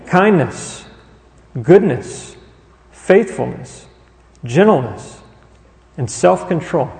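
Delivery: each word set apart by a pause; deliberately slow reading. Real, not acted. Preacher makes the congregation listen to each trait, slow and deliberate. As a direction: take the list slowly, word by word. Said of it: "You may think I'm reading this too slow".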